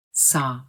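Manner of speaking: "Sa" open, no closing glide; "Sa" mouth left open; American saw. Shown as a demonstration. Teacher repeats the correct vowel. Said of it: "It is not sao".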